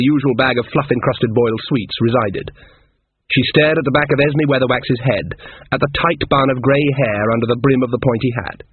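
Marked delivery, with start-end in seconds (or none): none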